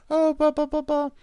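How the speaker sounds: very high-pitched blah-blah; mock chatter. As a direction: imitate friends' texts in a squeaky babble. Mimics texts he gets.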